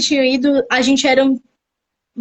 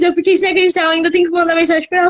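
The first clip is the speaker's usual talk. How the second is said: ordinary delivery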